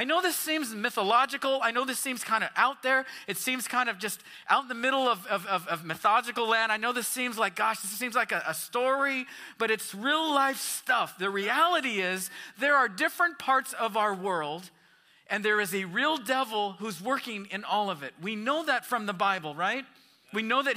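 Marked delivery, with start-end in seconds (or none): none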